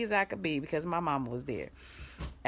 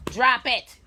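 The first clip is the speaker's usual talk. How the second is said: loud sharp command; firm shout; 5 semitones higher, 10 dB louder than usual. Real, not acted. To her dog chewing a shoe, a loud firm command. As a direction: bark it at the dog.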